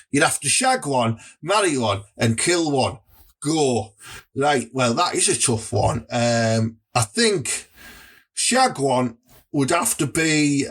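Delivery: ordinary talk, nothing marked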